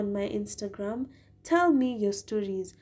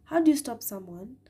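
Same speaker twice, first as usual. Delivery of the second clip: ordinary delivery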